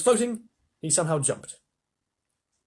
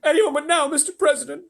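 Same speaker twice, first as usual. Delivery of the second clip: higher, loud radio shout; shouting astronaut; 14 semitones higher, 6 dB louder, faster than usual. A narrator's reading. Shanks shouts to the president, higher and loud. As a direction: yell the report.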